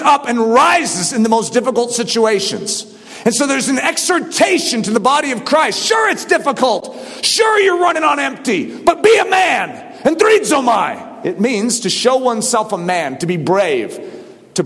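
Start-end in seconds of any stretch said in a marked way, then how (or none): 3.2-11.3 high, driving pulpit exhortation; forceful preaching. Real, not acted. Preacher urging church to give, forceful exhortation. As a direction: press it home at full force.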